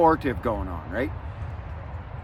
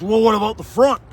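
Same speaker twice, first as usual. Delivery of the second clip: pitched-up, louder questioning officer; mock cop voice; 6 semitones higher, 4 dB louder than usual. Officer quizzing the cyclist about his front light, mock cop voice. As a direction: play the doubtful cop asking about the front.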